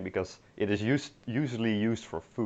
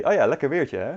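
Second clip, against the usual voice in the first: genuine; 5 semitones higher, 4 dB louder, faster than usual. Shown as a demonstration. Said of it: "genuinely say".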